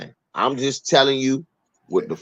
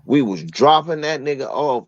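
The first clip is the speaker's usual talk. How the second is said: ordinary talk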